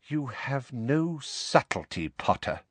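slow, scornful put-down; sneering teacher. Acted. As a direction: savour the insult slowly. Snape voiced by narrator.

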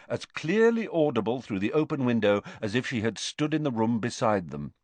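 ordinary delivery, nothing marked

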